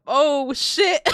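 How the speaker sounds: loud surprised outburst; excited shout. Real, not acted. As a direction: blurt it out loud and thrilled. Reacts to dance.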